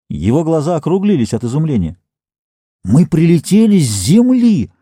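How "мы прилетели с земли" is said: insistent cry. Acted. Astonished captain insists loudly.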